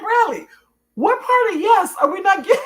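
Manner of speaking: high, loud retold protest breaking into laughter; hammed-up squeal of disbelief at being asked; mock exasperation. Mom retelling her reply to Bradley's repeated question, exasperated.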